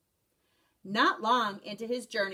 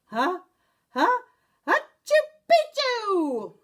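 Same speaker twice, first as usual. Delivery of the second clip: a building sneeze that bursts high and loud; sneezed password; 10 semitones higher, 7 dB louder, slower than usual. A narrator's reading. Kitten sneezes the secret password into Don Diego's ear, building sneeze. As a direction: wind up and sneeze the word out. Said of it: "half sneezed, half spoke".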